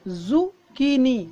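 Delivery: जुकीनी drawn out slowly, syllable by syllable; slow careful zucchini. Shown as a demonstration. Teacher modelling जुकीनी slowly for students. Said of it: "इसकी जो pronunciation है दोस्तों".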